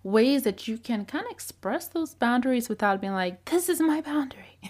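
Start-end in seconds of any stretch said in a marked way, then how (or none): 3.5-4.6 breathy whispered "This is my boundary."; hushed mock boundary line; whispered mock statement. Shown as a demonstration. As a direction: whisper the line as a mocked example.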